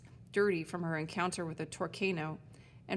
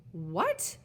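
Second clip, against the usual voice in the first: screeched. Acted. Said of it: "she screeched".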